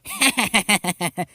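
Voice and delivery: high, wild villain cackle; mad, hammed-up laughter; maniacal laugh. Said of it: "She laughed maniacally".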